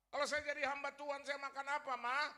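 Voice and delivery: loud, high, demanding son; insolent challenge. Acted. Son challenging his mother about becoming a pastor, insolent. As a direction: throw the question at her loudly. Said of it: "Kurang ajar sama ibu saya dulu".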